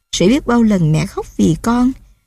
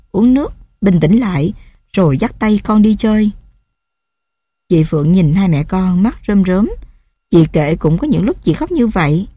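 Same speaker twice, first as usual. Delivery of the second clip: ordinary delivery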